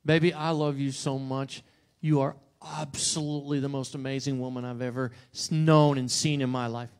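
tender. Shown as a demonstration. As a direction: pour it out gently to her. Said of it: "Big difference".

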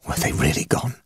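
breathless frightened whisper. A narrator's reading. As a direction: whisper it in dread. Narrator in terror in church.

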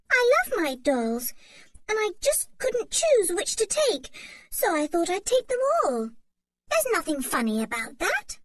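put-on light, high girlish voice, full of longing; a little girl dreaming of summer; eager young girl. A narrator's reading. Anne tells Mother she's tired of tunics, eager girl.

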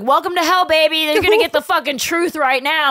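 loud, high mock threat; mock menace. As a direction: greet them into hell with glee. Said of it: "I'm like".

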